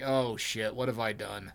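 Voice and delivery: low, soft retold regret; hushed mock realization. Co-host voicing Cotton after his rampage, quietly stricken. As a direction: sink into a quiet, deflated quote.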